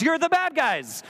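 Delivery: «You're the bad guys» with a high, slow, emphatic rise on «You're the»; slow down and hit «the bad guys» high; stress on the bad guys. Real, not acted. Preacher punches the point.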